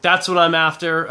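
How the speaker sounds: ordinary talk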